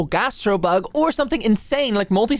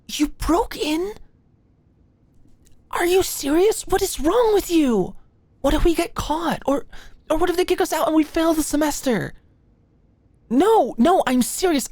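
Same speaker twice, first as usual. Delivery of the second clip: higher, rising alarm at being caught; panicked disbelief; 9 semitones higher than usual. Acted. To the friend who broke into the lab, panicked and high. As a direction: voice climbs in a frantic spiral of worry.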